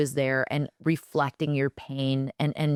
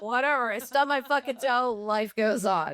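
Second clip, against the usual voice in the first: dismissive shrug; 7 semitones higher than usual. Retold or imitated. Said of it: "I'm like".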